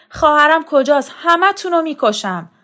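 high, loud angry shout; furious threat. A narrator's reading. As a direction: rage at those hiding his sister. Brother threatens the women. (فریاد خشمگین و تهدیدآمیز)

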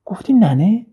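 can't believe the chickpea called her granny; astonished old woman. A narrator's reading. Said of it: "پیرزن گفت".

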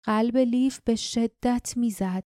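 ordinary delivery, nothing marked

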